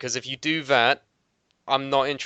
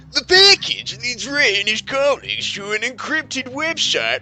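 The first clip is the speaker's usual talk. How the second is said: high dramatic trailer narration; movie trailer voice; 10 semitones higher than usual. Pretend. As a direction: sell it like a blockbuster teaser. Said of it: "my best trailer voice".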